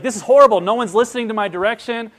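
high, loud exasperated complaint; loud mock despair; exasperated kid. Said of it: "he's like".

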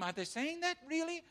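can it really be; thrilled surprise at the news; delighted surprise. Real, not acted. Hearing Muslims fear their God.

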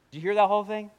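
usual speaking voice

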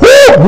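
short high whoop; quick high-pitched "whoo" of mock effort; exaggerated "whoo". Acting out strain once more.

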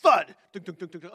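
one big impact and its clattering bounces; imitated thuds. Imitating stuff chucked across the room, percussive thuds. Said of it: "stuff flying across the room".

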